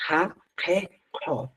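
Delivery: "Ka, ke" breathed out hard, with a puff of air after the k; aspirated syllables. Shown as a demonstration. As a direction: let the air burst after each k. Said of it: "Es aspirado". (sílabas aspiradas con soplo de aire)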